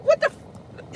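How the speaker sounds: high, clipped-off "what the f-" in disbelief; exasperated outburst. Retold or imitated. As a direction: cut yourself off mid-curse, shocked. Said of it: "I'm like".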